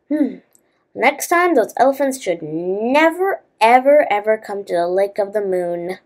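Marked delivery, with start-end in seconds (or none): none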